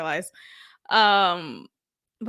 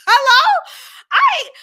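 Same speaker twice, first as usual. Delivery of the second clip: exasperated shout; 13 semitones higher, 10 dB louder, slower than usual. Real, not acted. Exasperated about dating advice.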